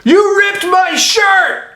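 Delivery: very high, slow, stretched-out hammed-up shriek; mock Nick Cage yell. Mimicking Nick Cage yelling at the alien about his shirt, hammed-up shriek. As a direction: drag out a ridiculous overacted yell. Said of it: "Nick Cage yells".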